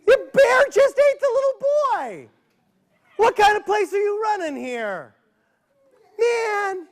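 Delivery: high, loud mock-indignant outburst; mock outrage. Storyteller feigns outrage at the story, high and loud. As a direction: squeal it in pretend shock.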